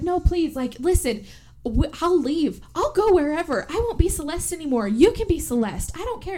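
high, frantic pleading; high panicked plea to her twin; desperate begging. Retold or imitated.

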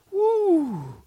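loud woo of disbelief; high woo. Real, not acted. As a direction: let out an amazed woo. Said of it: "enough of the noise effects".

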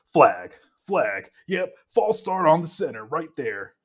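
lightly acted ref announcing a penalty, loud; mock referee call. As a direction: imitate a ref calling it out, punchy. Mimicking refs calling a penalty.

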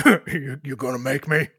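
lightly voiced challenging half-orc. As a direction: dare him, as the big brute. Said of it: "The half-orc looks at you and says".

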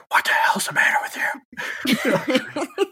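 slow breathless shout-whisper, pitched high; whisper yelling. Retold or imitated. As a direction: yell it but only in a whisper, slowly. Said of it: "the whisper yelling".

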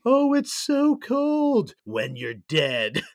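slow, high-pitched ghostly moan, stretched out loud. Acted. Ghost complaining about being dead, high eerie wail. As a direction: draw out every word like a wailing spirit. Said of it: "It's like a ghost voice".

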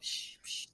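unvoiced psh psh like milk squirting; whispered psh spray sound. Imitating a mom spraying milk, psh psh.

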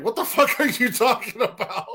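high, laughing incredulous retort; laughing disbelief. Retold or imitated. Retold reply, laughing.

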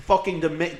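usual speaking voice

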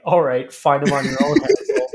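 laughing retort. Retold or imitated. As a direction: imitate the kid's retort through laughter. Acts out the kid, laughing.

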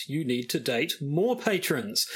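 ordinary delivery

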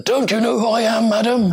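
high, put-on grand old visitor; imitated indignant old man. Famous old archaeologist to a site supervisor, imitated indignation. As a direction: pull rank in a mock-affronted voice. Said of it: "he said".

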